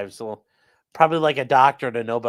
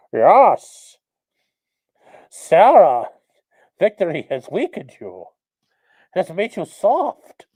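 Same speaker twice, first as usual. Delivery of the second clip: loud, muffled menacing villain into a cup; Bane voice; 4 semitones higher, 10 dB louder, slower than usual. Shown as a demonstration. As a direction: growl it menacingly through a cup. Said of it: "I like to do the Bane voice by talking to a cup".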